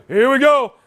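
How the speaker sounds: high, loud hammed-up shout; mock game-starting yell; imitated call-out. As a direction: call it out like a lame quarterback. Imitates Brett's call before throw.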